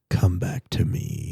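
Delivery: low, slow, soft guru voice; mock Buddha whisper. Egg rolls luring the eater back, mock mystic whisper. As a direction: murmur it low and drawn out, like a mystic. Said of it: "It's like Buddha whispering in your ear".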